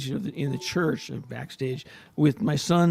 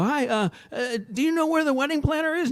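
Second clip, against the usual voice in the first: much higher, flustered question; flustered self; 11 semitones higher than usual. Retold or imitated. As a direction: play yourself lost and clueless. Father lost at the wedding.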